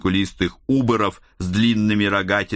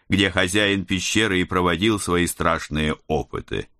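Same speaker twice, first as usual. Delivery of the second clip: ordinary delivery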